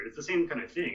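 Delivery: ordinary talk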